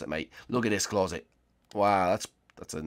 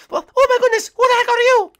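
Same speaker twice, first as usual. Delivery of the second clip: very high, loud squeaky cartoon voice; startled cat character; 13 dB louder than usual. Startled by strangers from the surface, high cartoon alarm.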